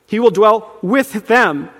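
slow, with "with" punched and set apart; stress on WITH. Shown as a demonstration. Preacher showing the repeated word, stress on "with". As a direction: hold back, then land hard on "with". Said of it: "for emphasis".

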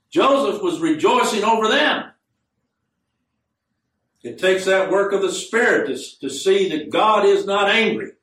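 high-pitched, emphatic sermon delivery; impassioned preaching. Real, not acted. Preaches with rising force.

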